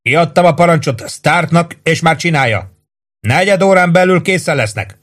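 sustained high-pitched call; narrated shout, pitch held up. A narrator's reading. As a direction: yell a report down from above. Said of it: "ordította le Warden".

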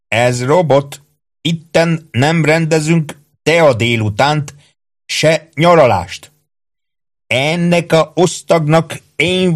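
high, slow, laboured commands; bossy, suspicious sergeant Ike. Acted. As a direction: assert your rank with drawn-out orders. (gyanakvó, parancsolgató, magas, vontatott őrmesterhang)

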